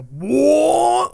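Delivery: high, loud mock engine whoa; engine roar imitation. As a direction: imitate a straining engine revving up. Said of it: "I hear the engine on the Suburban go".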